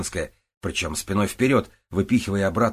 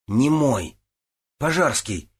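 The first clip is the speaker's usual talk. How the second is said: higher-pitched, excited outburst; amazed exclamation; 6 semitones higher, 4 dB louder, slower than usual. A narrator's reading. Zucker examines the returned knife, exclaiming excitedly. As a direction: cry out in delighted astonishment. Overjoyed discovery about the knife.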